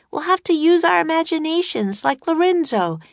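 high, projected urging; Annie shouting. A narrator's reading. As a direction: keep calling it out in a high voice. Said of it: "shouted Annie".

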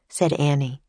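usual speaking voice

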